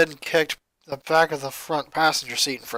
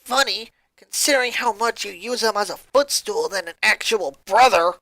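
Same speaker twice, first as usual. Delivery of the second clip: a snide jab; sarcastic retort; 3 semitones higher than usual. Acted. Luna retorts to Lori with sarcasm. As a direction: twist the knife sarcastically. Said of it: "Luna retorted".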